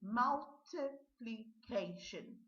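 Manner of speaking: "Multiplication" stretched out as mul-ti-pli-KAY-shun; very slow, syllable by syllable "Multiplication"; slow model word. Shown as a demonstration. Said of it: "Well done".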